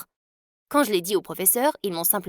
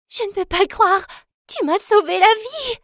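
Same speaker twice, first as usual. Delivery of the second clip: much higher, louder amazed thanks; a rescued girl's high, loud amazement; breathless gratitude; 11 semitones higher, 4 dB louder than usual. Acted. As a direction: gush in disbelief at being saved. Diane thanks her rescuer.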